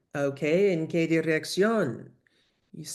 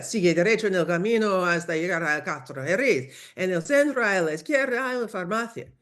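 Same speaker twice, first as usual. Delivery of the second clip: rattled off in one quick run; hurried local giving directions; rapid directions. Acted. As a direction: fire off directions too fast to follow. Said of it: "despacio, por favor".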